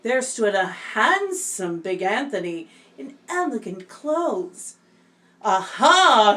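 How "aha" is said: loud triumphant aha; shouted. Acted. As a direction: shout it out in delight. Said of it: "shouted Big Anthony".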